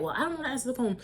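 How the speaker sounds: high-pitched protest without shouting; not yelling. Retold or imitated. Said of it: "I wasn't yelling, but I was like".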